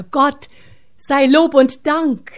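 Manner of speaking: lauter, hoher Jubelruf; laut und erleichtert ausrufen; erleichterter Ausruf. Acted. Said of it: "Da rief einer". (Soldat ruft erleichtert über die Nachricht, laut)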